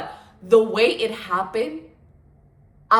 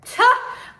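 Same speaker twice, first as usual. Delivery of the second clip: high breathy laugh; 7 dB louder than usual. Real, not acted. Laughs at herself, apologizing.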